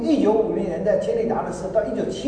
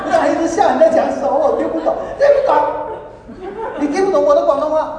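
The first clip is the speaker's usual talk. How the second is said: loud, high words through laughter; real laughter over the dialogue; laughing; 10 semitones higher, 8 dB louder than usual. Real, not acted. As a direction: speak while cracking up. Laughing retelling the exchange.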